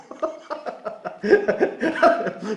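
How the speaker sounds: long, high breathy laugh; real laughter. Real, not acted. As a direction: burst out laughing at your own act. Laughs at own acted denial.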